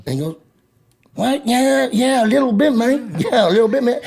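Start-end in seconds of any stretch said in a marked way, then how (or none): 1.1-4.0 higher, sheepish laid-back gang guy; impression of Jack. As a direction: do Jack, higher and a bit embarrassed. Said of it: "I'm doing a spot on Jack".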